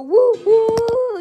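high, loud cheer; elated whoop. Acted. Character thrilled by an arrow, high loud whoop.

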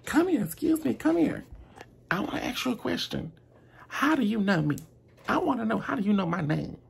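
shrill-sounding mimic of a pushy diner. Customer summoning waiter, voiced high.

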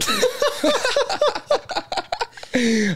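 high laughing fit. Real, not acted. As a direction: crack up at the friend. Burst of laughter at friend. (carcajada aguda)

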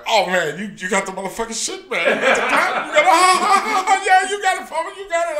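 high, laughing teasing; laughing mockery. [name] teasing his friend, high and laughing. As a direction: cackle the taunt at him.

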